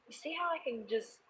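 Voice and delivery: hushed unvoiced aside; whisper. Real, not acted. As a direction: lean in and whisper it.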